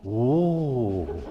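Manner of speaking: drawn-out, swooping "ooh"; funny vocal tic. Retold or imitated. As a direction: imitate his habitual odd ooh. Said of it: "He'd do that a bunch".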